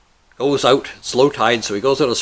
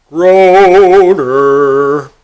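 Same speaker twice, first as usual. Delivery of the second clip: loud, long-drawn segment call; booming announcement; 5 semitones higher, 13 dB louder than usual. Pretend. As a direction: stretch it out and belt it like a mock announcer.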